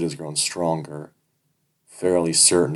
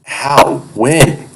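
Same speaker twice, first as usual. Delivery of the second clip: higher, louder stammered questions; stammering; 9 semitones higher, 13 dB louder than usual. Acted. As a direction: blurt it out in shock, higher. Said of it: "I stammer".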